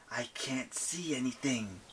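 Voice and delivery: slow frightened whisper; scared whisper. Acted. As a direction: breathe it, unnerved. Nyx panicked whisper.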